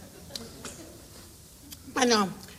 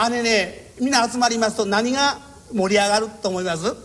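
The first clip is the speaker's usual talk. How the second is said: usual speaking voice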